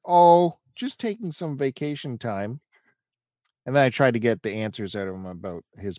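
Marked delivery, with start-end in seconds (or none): 0.1-2.6 lightly voiced breezy excuse, high and loud on oh; mocking reply. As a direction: imitate a carefree brush-off. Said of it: "these are actual texts".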